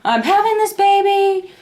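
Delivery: acted-out upset whine; upset protest. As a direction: wail it in mock distress. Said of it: "I was so upset".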